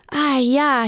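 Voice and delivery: a light cry of alarm; understated surprised cry; cried out. A narrator's reading. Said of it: "he cried".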